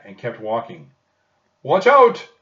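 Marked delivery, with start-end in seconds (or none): 1.6-2.3 high, very loud yell; yelled warning. A narrator's reading. Cart driver yelling at Jack and Annie in the road. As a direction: bark a warning to get out of the way. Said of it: "a cart driver yelled".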